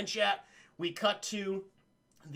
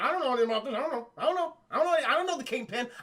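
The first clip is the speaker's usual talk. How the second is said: rising, louder babbling; panicked denial; 6 semitones higher, 3 dB louder, faster than usual. Retold or imitated. Norm frantically denying any Kingpin link, rising pitch. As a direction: stammer denials faster and higher. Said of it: "Norm's like".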